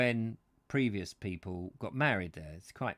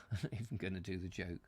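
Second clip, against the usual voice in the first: laughing; 3 semitones lower, 8 dB quieter, faster than usual. Real, not acted. Chuckles while abandoning own joke.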